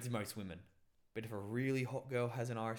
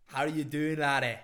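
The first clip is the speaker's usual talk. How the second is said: loud, higher-pitched Scottish greeting; Scottish accent; 3 semitones higher, 9 dB louder than usual. Acted. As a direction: burst in with a mock Scottish accent. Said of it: "fucking Sarah comes in".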